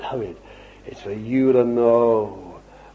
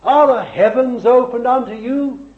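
raised, ringing preacher's question; forceful challenge; 12 semitones higher, 6 dB louder than usual. Real, not acted. Preacher challenging the congregation, forceful question. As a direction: throw the question out to the whole congregation.

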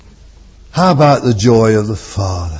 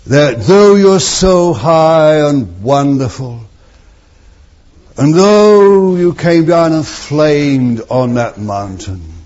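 raised, ringing prayer to the Lord; fervent preaching prayer, louder; 5 semitones higher, 3 dB louder than usual. Acted. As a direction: lift it up to God with fervour. Preaching at full force.

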